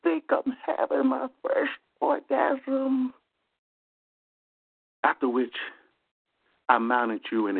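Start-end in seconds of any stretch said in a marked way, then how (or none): 0.0-3.1 very high, slow ecstatic wail; ecstatic cry. Retold or imitated. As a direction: cry out in rapture, drawn out. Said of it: "you started screaming".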